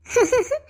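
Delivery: a short bright laugh of joy; happy laugh. Acted. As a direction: laugh with delight at the news. Mother happy about pregnant daughter.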